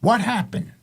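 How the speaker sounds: lightly acted stern parental question; questioning father. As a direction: imitate a dad confronting his kid. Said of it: "my father said".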